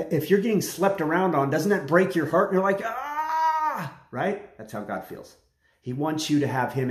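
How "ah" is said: high, hurt gasp; a cry of heartbreak on being cheated on; high stricken cry of betrayal; pained gasp. Acted.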